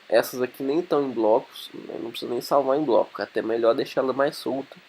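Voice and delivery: ordinary talk, nothing marked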